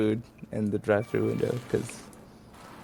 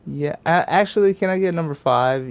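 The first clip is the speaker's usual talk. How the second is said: raised, wavering customer voice; change your mind mid-order, pitched up; obnoxious indecision; 7 semitones higher, 3 dB louder than usual. Retold or imitated. Prank customer changing the order around, mildly obnoxious. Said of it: "obnoxious and, like, changing my order around".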